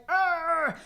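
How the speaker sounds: short exasperated mock growl, loud and high; half-playful angry grunt of frustration; frustrated growl. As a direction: let out a fed-up "Arr!" like a stressed mom. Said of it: "Sometimes I feel like".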